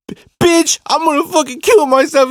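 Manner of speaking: very high, loud mock outburst; frantic threat. Cousin rings girlfriend back with a threat, hammed-up frantic yell. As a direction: blurt it out, imitating a hysterical boyfriend.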